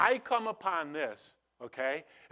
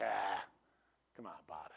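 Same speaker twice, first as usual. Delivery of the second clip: mock dismissive self-talk; 7 semitones lower, 7 dB quieter than usual. Inner voice sees the dog.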